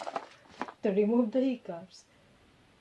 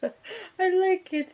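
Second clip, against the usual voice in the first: laughing; 9 semitones higher, 3 dB louder, much slower than usual. Real, not acted. Laughing at cute hiccups.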